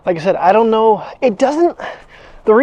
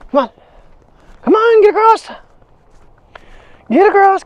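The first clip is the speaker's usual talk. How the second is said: much higher, louder cheer; high coaxing call; 13 semitones higher, 5 dB louder than usual. Real, not acted. Owner urging her dog across the road in a high loud call.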